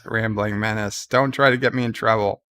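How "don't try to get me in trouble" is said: usual speaking voice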